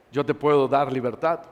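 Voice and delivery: low, soft, steady reassurance; calm voice of Jesus. Acted. Jesus answering the pleading sinner: low soft reassurance. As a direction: answer gently from above, unhurried.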